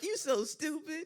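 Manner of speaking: high, slow put-on girlfriend; imitating an amused girlfriend; mock girlfriend voice. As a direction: tease in a slow, high-pitched voice. Girlfriend reacting, acted.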